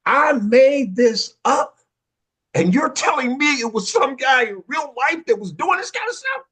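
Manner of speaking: hammed-up disbelief as he works it out; amazed inner shout. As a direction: blurt it out, stunned it was real. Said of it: "thinking in my mind".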